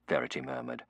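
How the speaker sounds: usual speaking voice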